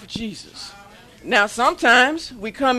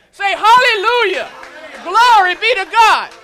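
loud, high call to praise; shouted praise; 7 semitones higher, 11 dB louder than usual. Real, not acted. Preacher shouting praise to the standing congregation. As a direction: lift the voice and shout it out. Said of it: "let's give God some praise".

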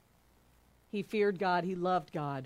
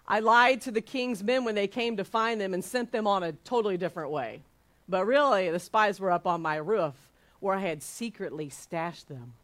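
ordinary delivery

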